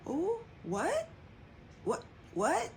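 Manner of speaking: high, startled repeated what; shocked disbelief. Retold or imitated. Reacting to partner's sudden bingo plan, shocked disbelief. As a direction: replay the jolt of hearing the news.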